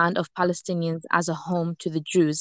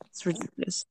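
whispered aside; 11 dB quieter, faster than usual. Real, not acted. Disgusted aside during partner's point.

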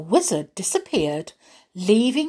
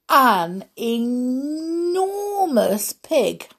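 "enormous" stretched very long on a rising pitch; very slow, high, hugely stretched "enormous"; drawn-out emphasis; 7 semitones higher, much slower than usual. A narrator's reading. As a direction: swell slowly through "enormous" for the big reveal. Storyteller's big reveal of the pig.

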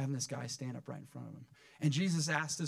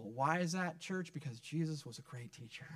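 normal talking voice

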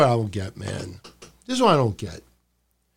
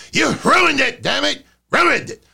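angry Vince shout; 11 semitones higher than usual. Retold or imitated. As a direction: scream it like a furious boss. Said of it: "You know".

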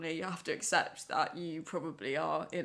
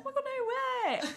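mock excited. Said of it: "I was like".

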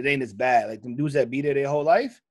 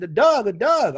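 higher, louder Baltimore "Doug"; emphatic mock Baltimore accent; imitated Baltimore accent; 7 semitones higher, 5 dB louder than usual. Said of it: "She said".

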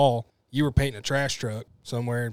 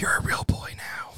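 whispering; 4 dB quieter than usual. Pretend. Teasing whisper to new cohost.

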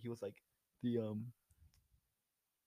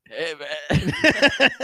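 loud hammed-up drunk drawl; badly faked accent like a drunk hobo; 3 semitones higher, 11 dB louder than usual. Co-host butting in, loud drunk hobo voice.

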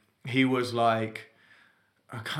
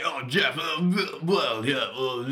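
posh toff; 2 semitones higher, 3 dB louder, much slower than usual. Retold or imitated. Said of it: "a proper, like, little toff".

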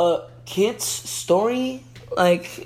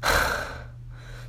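sighing Gretel; 9 semitones higher, 3 dB quieter than usual. A narrator's reading. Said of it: "sighed Gretel".

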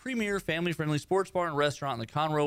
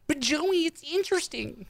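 high whiny nagging voice; obnoxious driver voice; 12 semitones higher than usual. Pretend. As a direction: whine like an annoying driver. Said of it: "That's my obnoxious driver voice".

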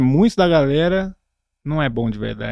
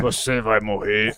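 slow, low menacing machine voice; microwave voice; 5 semitones lower, slower than usual. Acted. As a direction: voice a killer microwave, slowly. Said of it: "Essa é a voz do micro-ondas".

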